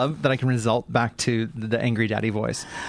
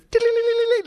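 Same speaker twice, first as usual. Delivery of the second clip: high rapid trilled scurry sound, louder; cartoon running noise; 4 dB louder than usual. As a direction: imitate cartoon feet scrambling away. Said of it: "like the Flintstones".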